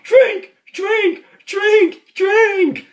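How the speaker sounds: hammed-up desperate old priest; repeated drink shout. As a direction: yell it over and over. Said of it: "tree going".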